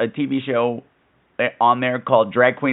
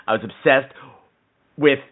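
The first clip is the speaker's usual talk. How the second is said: ordinary talk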